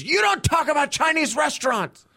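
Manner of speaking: hammed-up furious patron; angry woman yelling. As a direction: squeal it like an outraged drunk woman. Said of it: "and she goes".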